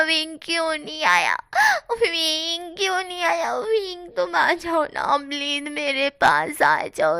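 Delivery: high, sobbing plea, breaking into wails. A narrator's reading. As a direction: beg through tears for him to come. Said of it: "रोते हुए वो कह रहा था".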